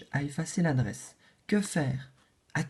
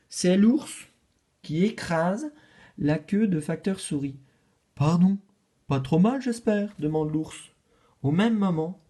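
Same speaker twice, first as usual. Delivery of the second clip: plain reading voice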